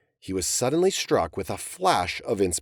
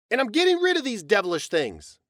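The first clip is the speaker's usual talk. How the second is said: shouted; 11 semitones higher, faster than usual. Acted. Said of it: "Joseph shouted".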